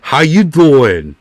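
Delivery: slow, drawn-out New York "How you doing"; New York accent. Shown as a demonstration. Ending his New York bit, slow New York accent. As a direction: stretch it like a New York charmer. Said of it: "let's see if I can still do it".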